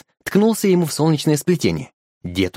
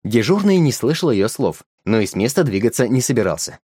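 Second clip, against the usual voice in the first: ordinary delivery, nothing marked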